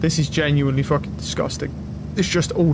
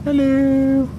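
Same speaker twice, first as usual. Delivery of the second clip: high, louder sing-song hello; playful high greeting; 11 semitones higher, 4 dB louder than usual. Pretend. Greeting in a funny voice.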